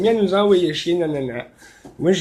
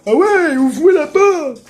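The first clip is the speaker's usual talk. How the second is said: shouted, higher, imitating the pushy neighbour; mock yell; 8 semitones higher, 5 dB louder than usual. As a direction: yell it like an annoying guy at the door. Imite le voisin qui crie. (imitation d'un voisin qui crie)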